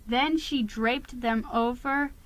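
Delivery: usual speaking voice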